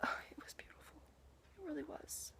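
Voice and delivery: barely audible, breathy whisper; awed whisper. Real, not acted. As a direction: whisper it in hushed awe. Awed aside about the people.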